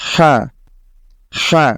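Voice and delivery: "হা" begun with a push of breath; air released first. Shown as a demonstration. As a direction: let air out first, then sound "হা". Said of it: "আপনাকে প্রথমে বাতাস বের করতে হবে". (আগে বাতাস ছেড়ে তারপর "হা")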